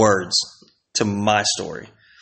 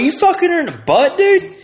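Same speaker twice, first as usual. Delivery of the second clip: high, loud incredulous question; stunned friend asking in shock; shocked disbelief; 4 dB louder than usual. Retold or imitated. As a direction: squeal it in disbelief. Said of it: "I'm like".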